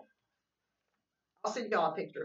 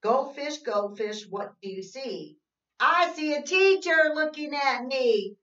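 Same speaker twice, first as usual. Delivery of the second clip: sing-song read-aloud, slow and even; reading to children; 8 semitones higher, 9 dB louder, slower than usual. Acted. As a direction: read the rhyme to little kids, slowly. Reads picture book.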